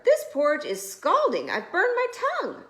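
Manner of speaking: high, loud, fast pained outcry; Papa Bear crying out. A narrator's reading. Papa Bear burning his tongue on porridge, high yelp. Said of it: "cried the old Papa Bear".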